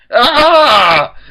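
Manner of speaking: very high, very loud wordless scream; frustrated scream. A narrator's reading. Twilight erupts before storming out, frustrated scream. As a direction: scream out all your exasperation.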